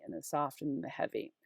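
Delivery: whispered aside; very soft, whispery teacher's aside; hushed repeat. Real, not acted. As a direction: drop to a whisper. Quiet aside repeating the pattern.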